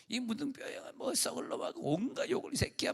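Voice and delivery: put-on high-pitched, thin taunting child's curse; mock child's taunting. Child cursing at a leper. (아이가 문둥병자를 놀리듯 높은 목소리로 흉내)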